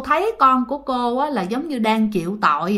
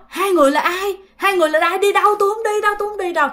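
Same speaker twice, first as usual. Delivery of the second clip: frightened cry; 8 semitones higher, much faster than usual. Retold or imitated. Woman resists two demons.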